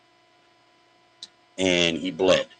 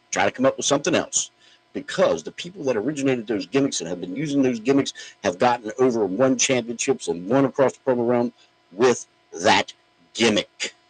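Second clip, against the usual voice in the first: ordinary talk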